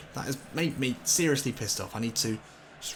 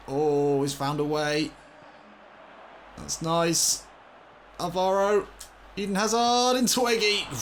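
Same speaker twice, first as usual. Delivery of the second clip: commentating a slick move, getting louder; rising excitement; 7 semitones higher, 4 dB louder than usual. Real, not acted. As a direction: voice climbing higher and louder into the finish. Building excitement on attack.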